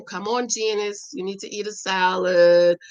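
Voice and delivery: low, drawn-out nagging to oneself; coaxing self-talk. Acted. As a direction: talk yourself reluctantly into a chore, dragging the vowels. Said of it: "This is how I talk to myself".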